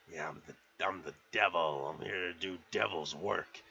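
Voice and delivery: lightly voiced intruder, pitched low; low devil intruder. Intruder announcing himself to Cliff, low mock menace.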